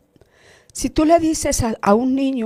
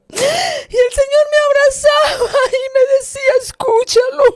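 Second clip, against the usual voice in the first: real crying; 11 semitones higher than usual. Real, not acted. Crying while recounting the Lord.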